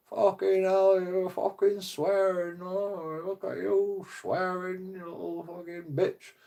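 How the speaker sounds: high, drawn-out taunting voice; mocking mum's swearing. Son taunting his mum with a high mocking voice. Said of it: "taking piss out of my mum".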